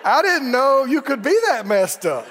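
chuckling through the words; laughing inner thought. Retold or imitated. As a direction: laugh while you say what you thought. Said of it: "I'm thinking".

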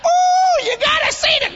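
shrieking high excited coo; squealing falsetto. Retold or imitated. Family cooing over baby.

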